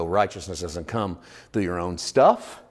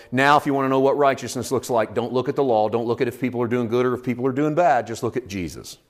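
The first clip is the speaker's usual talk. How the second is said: normal talking voice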